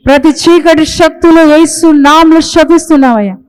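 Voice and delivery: forceful, insistent prayer, voice lifted; fervent prayer. Real, not acted. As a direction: pray with full force over the congregation. Fervent prayer of blessing and rebuke.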